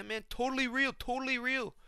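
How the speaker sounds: high-pitched, insistent fighter impression; Renato Moicano. Convincing friends the conspiracy is true, high insistent impression. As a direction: imitate an excited conspiracy theorist vouching for it. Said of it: "He's like".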